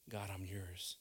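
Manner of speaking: usual speaking voice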